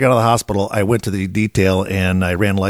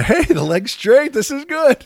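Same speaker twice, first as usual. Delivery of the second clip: much higher, slow, surprised relief; mock-cheer at finding the leg straight; higher slowed voice, pleasantly surprised; relieved self-talk; slower than usual. Retold relieved self-talk, eyes closed.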